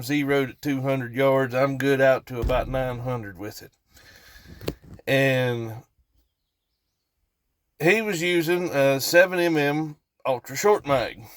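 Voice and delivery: normal talking voice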